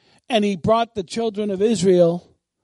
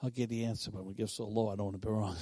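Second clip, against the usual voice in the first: very soft, low mumble; unsure, low answer; 11 semitones lower, 13 dB quieter, faster than usual. Retold or imitated. Preacher imitates a listener answering, hesitant and low. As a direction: answer as if scared of being wrong. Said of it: "she was getting that answer like".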